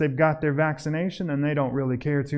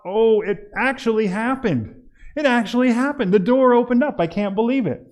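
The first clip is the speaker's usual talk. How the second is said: higher and louder, half-laughing amazement; mock disbelief; 8 semitones higher, 4 dB louder than usual. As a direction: act stunned the door really opened. Acted shock at door opening.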